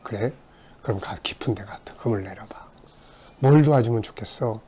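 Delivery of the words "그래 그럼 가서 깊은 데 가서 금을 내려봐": hushed, gentle whispered instruction; soft whispered advice. Retold or imitated. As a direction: tell them softly what to do. Jesus answering fishermen. (예수님이 어부들에게 속삭이듯 다정하게)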